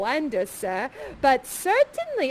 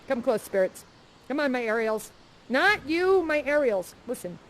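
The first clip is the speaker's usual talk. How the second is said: usual speaking voice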